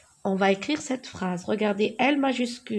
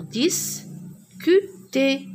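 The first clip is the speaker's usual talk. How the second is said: slow model reading; 3 semitones higher, much slower than usual. Shown as a demonstration. Teacher modelling a word for pupils, drawn out slowly. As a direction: draw out discuter syllable by syllable. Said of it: "J'écris ici discuter".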